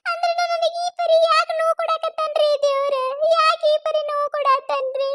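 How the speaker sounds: high, weeping plea; tearful pleading. Acted. Daughter-in-law pleads with mother-in-law through high tears. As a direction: cry it out, asking why God hurts her. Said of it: "ಒಟ್ಟ ನೀ ಅಳಬೇಡ".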